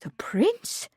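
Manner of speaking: hushed, wondering whisper; awed whisper. A narrator's reading. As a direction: breathe it in awe. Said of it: "she asked, awed".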